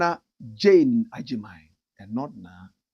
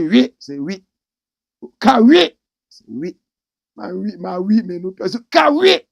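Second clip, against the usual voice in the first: tug of war; 5 semitones higher, 10 dB louder than usual. Retold or imitated. Senior and junior pronunciation tug-of-war.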